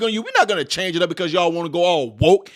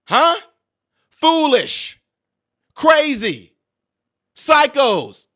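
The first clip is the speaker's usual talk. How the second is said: barked labels; 3 semitones higher, slower than usual. Real, not acted. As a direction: spit out each label. Firing off insulting labels.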